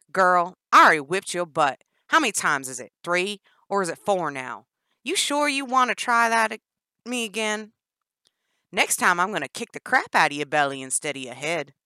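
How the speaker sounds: loud sneering twang; Danny's mocking threat. Danny taunting Jennifer, loud sneering threats.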